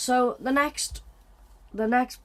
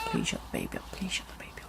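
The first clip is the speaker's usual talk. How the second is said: soft desperate whisper; whispered plea; 8 dB quieter, much faster than usual. Acted. Passenger whispering a plea about the baby. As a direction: beg under your breath.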